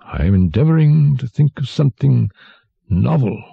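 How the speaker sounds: abstracted dwarf. A narrator's reading. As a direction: answer as a bewildered, wine-addled jester.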